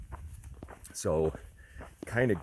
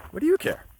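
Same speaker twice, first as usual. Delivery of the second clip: much higher and louder, rising on "you"; emphatic question; 9 dB louder than usual. Shown as a demonstration. Hiker repeats the quote with bold intonation. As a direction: fling "care" up as a real question. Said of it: "in terms of intonation".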